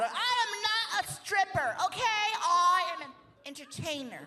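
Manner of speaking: high-pitched offended huff; mock indignant dancer. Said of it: "They'll get mad".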